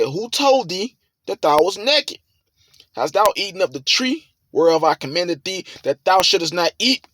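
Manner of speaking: high, forceful scripture preaching. Acted. Preacher voices the Lord questioning Adam, forceful. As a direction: lift it and drive the verses home.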